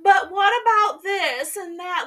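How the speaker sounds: high, loud, slow whiny mock plea; mocking whiny heroine. Friend mimicking the heroine's excuses, whiny. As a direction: whine out her excuses like a fretful girl. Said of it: "she's like".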